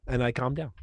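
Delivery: ordinary talk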